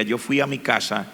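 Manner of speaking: usual speaking voice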